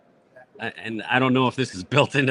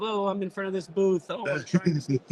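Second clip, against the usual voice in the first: high-pitched parody of cutesy video chatter; mock a perky booth-promo video; put-on sweet influencer voice, pitched up; 9 semitones higher than usual. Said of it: "cutesy videos of".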